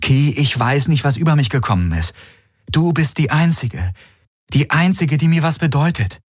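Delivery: high, pleading declaration; anguished, pitched high; desperate crying out. A narrator's reading. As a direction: plead with the one who has gone. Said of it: "Er hörte sich selbst aufschreien".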